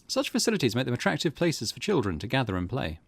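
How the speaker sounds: ordinary talk, nothing marked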